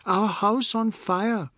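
high, slow, stretched-out cry; children crying the alarm; urgent alarm. A narrator's reading. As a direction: announce the disaster loudly. Children raising fire alarm.

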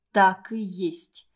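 ordinary delivery, nothing marked

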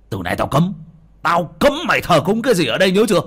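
fast, high, loud warning; angry order. Acted. Father forbidding his son any worship here, angry shouting. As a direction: lay down the ban forcefully.

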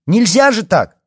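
high, loud protest; outraged shout. A narrator's reading. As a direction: yell it in shocked indignation. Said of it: "крикнула Алиса Лии".

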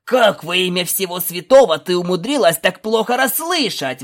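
loud, exasperated outburst; indignant. A narrator's reading. Nobody berating Scootaloo, loud and indignant. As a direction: rage at the mix-up. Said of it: "возмутился Никто".

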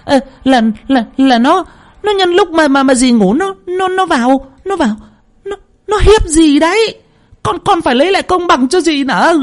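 a guilty woman begging, voice cracking; panicked stammering plea. Acted. Stammering, lying aunt pleading. (giọng run rẩy lắp bắp van xin)

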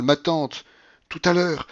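agitated, trembling. A narrator's reading. General pleading with his aunt, agitated. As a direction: plead with her anxiously. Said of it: "sa voix tremblait".

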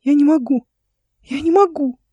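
put-on high, whining child's protest; high-pitched boyish despair; distressed little boy. A narrator's reading. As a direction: plead helplessly as a small boy. Boy protests he cannot throw.

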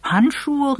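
high-pitched, let-down question; disappointed exclamation. A narrator's reading. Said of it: "rief Eve enttäuscht".

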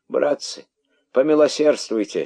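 high, imploring call; pleading cry. A narrator's reading. Herald begging his brothers for mercy, pleading. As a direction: beg the crowd for mercy. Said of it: "закричал находчивый".

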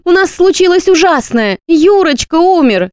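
mother moaning tragically down the phone, high and slow; tragic moan. A narrator's reading. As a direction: wail the bad news like a stage tragedy. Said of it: "простонала та трагическим голосом".

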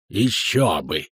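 low, slow caustic jab. A narrator's reading. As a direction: drag out the retort with scorn. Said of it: "язвительно прервал краснолюд". (язвительно, низко и с растяжкой)

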